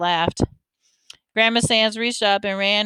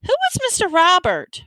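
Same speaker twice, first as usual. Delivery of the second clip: worried; 9 semitones higher than usual. A narrator's reading. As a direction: call timidly, then let worry push the question high. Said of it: "Mama sounded worried".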